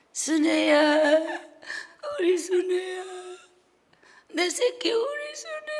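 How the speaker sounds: slow, tearful, rising to a high cry; calling her child through tears; mother weeping. Retold or imitated. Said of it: "하면서 엄마가 이 손으로". (울먹이며 부르는 엄마)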